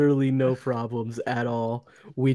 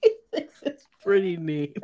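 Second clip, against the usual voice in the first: higher, giggled repeat; laughing echo; 5 semitones higher than usual. Retold or imitated. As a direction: repeat it while cracking up. Cracks up echoing the line.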